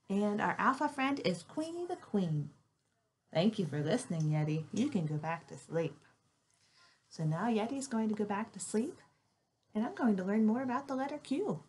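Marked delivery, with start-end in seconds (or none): none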